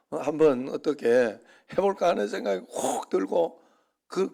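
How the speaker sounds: ordinary talk, nothing marked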